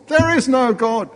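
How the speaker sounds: high, thinner echo of a yell; high-voiced retort. Atheist neighbour replying to her prayer, high retort. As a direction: call it back high without much volume.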